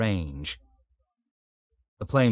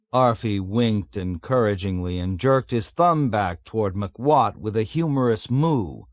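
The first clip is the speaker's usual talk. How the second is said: ordinary delivery, nothing marked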